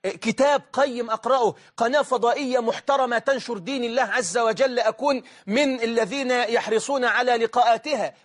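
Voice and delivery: normal talking voice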